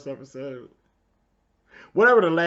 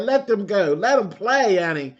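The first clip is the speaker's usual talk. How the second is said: call out; 7 semitones higher, 8 dB louder than usual. Retold or imitated. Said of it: "I said".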